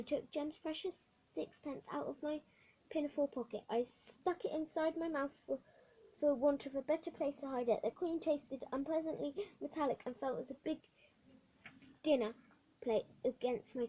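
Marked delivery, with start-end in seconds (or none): none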